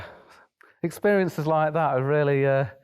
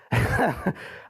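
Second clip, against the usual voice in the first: laugh; 7 semitones higher than usual. Real, not acted. Retelling riding with pigs.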